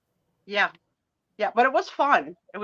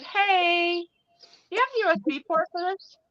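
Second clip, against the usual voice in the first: high mock voice; 9 semitones higher than usual. Retelling call to the millennial.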